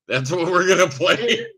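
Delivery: laughing announcement. Real, not acted. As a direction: giggle through the punchline. Joke game announcement, laughing.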